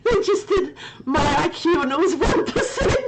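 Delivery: high, giggling report; laughing through words. Real, not acted. Telling chat her IQ was one percent, laughing through it. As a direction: tell it while cracking up.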